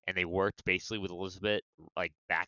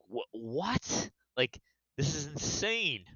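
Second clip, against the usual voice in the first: stunned disbelief; 6 semitones higher, slower than usual. Retold or imitated. Reacting to Zeniths first appearing.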